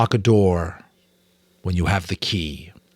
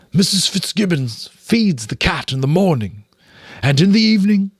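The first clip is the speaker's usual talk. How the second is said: raised leader-rat voice, explaining; Nicodemus, higher; 9 semitones higher, 3 dB louder than usual. Acted. Nicodemus explaining the cat's bowl to Mrs Frisby, high. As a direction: patiently explain how it works.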